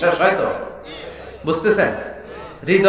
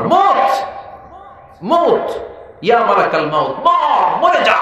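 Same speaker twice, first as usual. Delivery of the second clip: commanding shout; 3 semitones higher than usual. Acted. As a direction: thunder the order down. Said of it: "আল্লাহ চিৎকার দিয়ে বলবেন".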